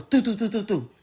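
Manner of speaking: rapid-fire "doo" gunshot imitation; imitated gunshots. Mother recounting shots heard from the front room, imitated gunshots. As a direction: mimic a burst of shots with the voice. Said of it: "all I heard was".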